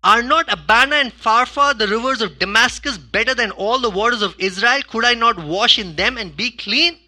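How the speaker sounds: loud, high ranting complaint; indignant Naaman. Acted. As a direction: rant like a proud offended general. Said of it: "And then he says".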